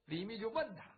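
ordinary delivery, nothing marked

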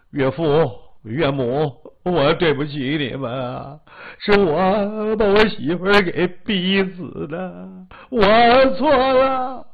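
drawn-out, wailing apology; acted guilt-stricken wail; tearful confession. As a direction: beg forgiveness, building to an anguished cry. Guilty husband confesses to his in-laws.

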